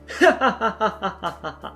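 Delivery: high, rapid cackling giggle; laughter. Acted. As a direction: burst out laughing, pitched high. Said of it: "broke out into laughter".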